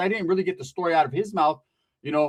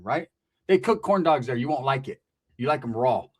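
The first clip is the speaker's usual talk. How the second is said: ordinary talk, nothing marked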